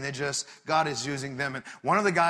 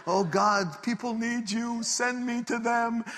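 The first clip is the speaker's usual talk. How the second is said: high, pleading prayer voice; fervent prayer; 8 semitones higher, slower than usual. Retold or imitated. Hugo praying alone in his car, fervent high plea. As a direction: cry out to God, slowing at the end. Acted prayer amid a blessed time.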